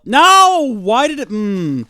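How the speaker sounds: very high, loud wail sinking to a frustrated hum; anguished outcry. Real, not acted. Gamer watching grass vanish, loud frustrated wail. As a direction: wail in protest, then give up mid-sentence.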